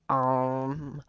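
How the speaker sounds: put-on dim-witted kid owning up, slowly; goofy kid voice. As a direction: play a sheepish, dopey kid. Voicing the goofy-looking kid.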